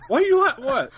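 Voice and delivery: sudden high, startled what; surprised what. Real, not acted. Narrator startled when his co-host laughs, high and surprised.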